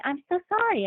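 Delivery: mock-sweet sorry, pitched very high; a hint of a syrupy co-star; fake apology. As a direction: imitate a hollow apology. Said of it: "then she says to me".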